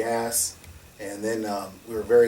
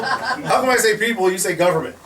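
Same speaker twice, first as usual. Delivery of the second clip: higher and louder through laughter; laughing incredulity; 10 semitones higher, 7 dB louder than usual. Real, not acted. Mocking the officials' priorities, laughing and loud. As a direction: laugh it out in disbelief.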